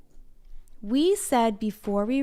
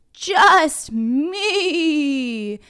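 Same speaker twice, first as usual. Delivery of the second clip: a bit bigger voice; 6 semitones higher, 5 dB louder than usual. A narrator's reading. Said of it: "in a little bit bigger of a voice".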